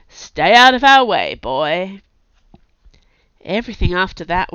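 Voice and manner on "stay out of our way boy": haughty, loud and dismissive; sniffy. A narrator's reading. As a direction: talk down to him. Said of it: "sniffed and said".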